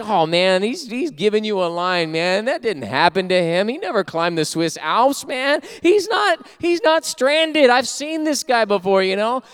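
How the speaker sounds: high, insistent warning; pleading that he's being conned; jaded street-smart warning. Retold or imitated. To the pastor about the beggar, high insistent warning.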